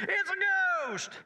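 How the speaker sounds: very high, drawn-out cry of fright; hammed-up scream of terror, words stretched; mock terrified cry. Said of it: "And somebody said".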